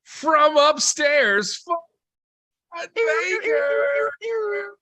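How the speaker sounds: booming arena announcer intro; stadium announcer. Acted. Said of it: "I'm working on my stadium voice".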